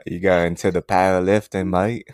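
put-on easy Australian accent at a normal pitch; Australian mate-to-mate accent. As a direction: slip into casual Aussie. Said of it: "do it in an Australian accent".

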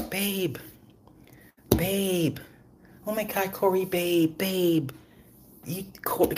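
high, soft mock call of babe; mock Kris calling Corey. Head witch calling her husband, high and soft. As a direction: imitate a doting wife calling babe.

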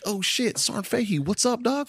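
high, eager mock of students greeting him; excited greeting. Former students hailing their old instructor, high and excited. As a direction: play the kids, thrilled to see their old instructor.

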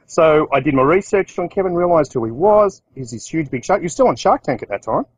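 ordinary talk

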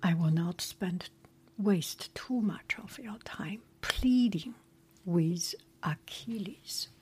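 ordinary delivery

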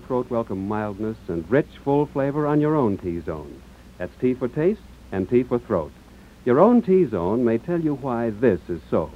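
usual speaking voice

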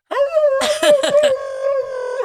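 high, loud dog howl. Pretend. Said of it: "and he'll go".

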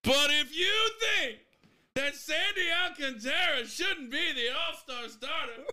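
high, slow outraged pundit; Stephen A. ranting. Pretend. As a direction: wind up into a hammed-up TV rant. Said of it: "Stephen A. getting mad about it".

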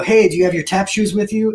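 hamming up grandma calling from the car; retold yell from car. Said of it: "yelled from the car window".

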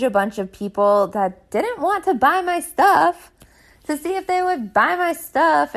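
high, rising exasperation; exasperated venting. Real, not acted. Woman venting about early-morning sales messages, exasperated. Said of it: "really annoyed, really sick of it".

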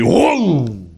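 high mock whoom as the swing hits; cartoon swoosh effect; whoosh of the ride. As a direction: sound the ride sweeping her away. Ride slams into her.

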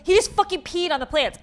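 high, loud mock outrage; scolding scream. Retelling a lady's scolding over dog pee, mock scream. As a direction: imitate a scandalized stranger's loud complaint. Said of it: "screaming at me".

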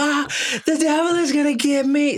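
put-on high panicked wail of a scared girl; panicked kid. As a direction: cry it out like a terrified kid. Little sister freaking out.

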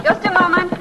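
high, loud call toward the door; answering the knock from inside the room, calling out. Acted.

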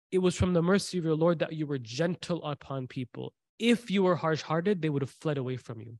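ordinary talk, nothing marked

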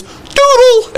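high, light sing-song "Doodle"; dad's sing-song call. Retold or imitated. Dad announcing a bathroom trip in a high sing-song. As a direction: toss it off high and bright. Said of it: "he just gets up and goes".

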